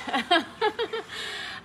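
high bright burst of laughter; delighted laugh. Real, not acted. Painter laughing at a viewer's comment, high and bright.

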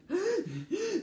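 high, sharp hyperventilating gasp; panicked gasp. Shown as a demonstration. Woman showing her panic attack, high gasping. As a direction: act out gulping for air. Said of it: "And I'll get like that".

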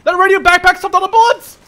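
loud, high astonished exclamation; excited high-pitched yell; amazed shout. Real, not acted. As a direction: shout in disbelief at your luck. Amazed survival.

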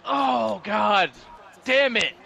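loud, pained gamer outburst; frustrated shout. Real, not acted. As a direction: yell it out in real frustration. Reacting to lost play in game.

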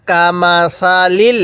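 slow, drawn-out tajweed chanting; teacher reciting slowly for students to copy; Quran recitation. Shown as a demonstration. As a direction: recite each syllable long and even. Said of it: "আমি যেভাবে উচ্চারণ করব".

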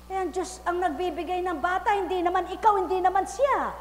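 loud, high fiery preaching; preaching at full force. Acted. Preacher calling out to the congregation, fiery and loud. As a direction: call it out to the crowd.

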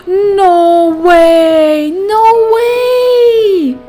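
loud high shocked cry. Real, not acted. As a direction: gasp then shout in disbelief. Shocked at game event.

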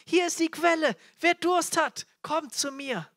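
loud, high, urgent invitation; crying out. Acted. Jesus calls the thirsty to himself, crying out. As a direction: shout the invitation across the crowd. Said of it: "der da schreit".

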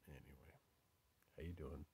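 soft whisper. Real, not acted. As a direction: drop to a hush for the greeting. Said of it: "I don't know why I'm whispering".

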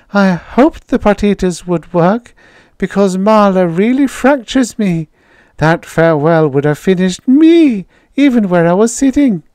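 put-on high, breathless young woman; shy young woman. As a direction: gush shyly. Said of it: "she giggled shyly".

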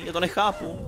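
normal talking voice

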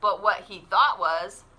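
put-on lively storybook narration for kids; animated picture-book read-aloud; reading to children. A narrator's reading. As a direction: read it like story time at the library.

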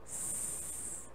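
a long voiceless sss like asking for silence; sss, quiet please; shushing S. Shown as a demonstration. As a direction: hiss the S like a teacher hushing the class. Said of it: "de quando a tia manda fazer silêncio".

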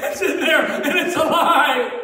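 high, loud, amazed carnival barker; hammed-up astonished street performer; Arthur Harris's showman act. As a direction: gasp and marvel at the hat to draw a crowd. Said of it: "he'd go".

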